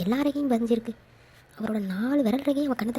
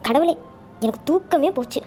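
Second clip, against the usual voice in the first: ordinary delivery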